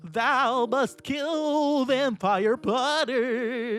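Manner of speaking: high, very slow, quavering villain yell; Voldemort. A narrator's reading. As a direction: drag out the command like a hammy villain. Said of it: "he yelled".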